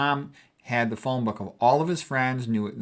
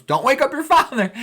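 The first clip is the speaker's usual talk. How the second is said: much higher and louder, ironic yell; mock loud mom; 14 semitones higher, 7 dB louder than usual. Mother yelling ironically on the stairs, mock loud. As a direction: bellow the warning so it wakes him. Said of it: "She goes".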